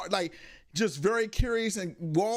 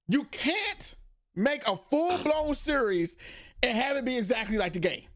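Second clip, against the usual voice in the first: ordinary talk, nothing marked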